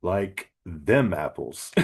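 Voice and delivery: put-on slow, drawn-out Boston accent; bad Boston accent. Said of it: "very bad Boston accent".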